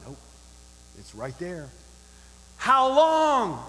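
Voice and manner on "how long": high, loud challenge; raised voice of public reproach; crying out. Acted. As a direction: cry it out to the crowd. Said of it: "She cries out".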